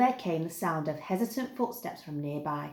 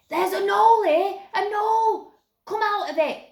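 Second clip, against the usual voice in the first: high, loud excited yelp of discovery; piping up excitedly; 13 semitones higher, 7 dB louder than usual. A narrator's reading. Youngest Snaggart finds the den and yells in, excited high shout. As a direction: squeal it like a kid who found something. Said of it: "piped up excitedly".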